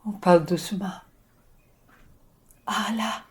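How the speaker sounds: ordinary talk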